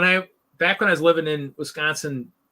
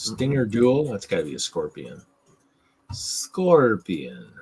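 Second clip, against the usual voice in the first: ordinary delivery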